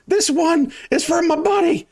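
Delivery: high-pitched vengeful yokel cry; avenging bandit. Acted. Bandit swings for his dead buddy, high battle cry. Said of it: "he goes".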